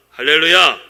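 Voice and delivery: shouted hallelujah, falling; loud hallelujah. Real, not acted. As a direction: call out hallelujah to the congregation. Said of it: "preacher's shout". (힘찬 외침의 할렐루야)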